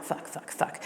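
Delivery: whispered, repeated curse; very soft, voiceless re-enacted cursing; whispered panic. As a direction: swear under your breath as if panicking. Retold silent panic in booth.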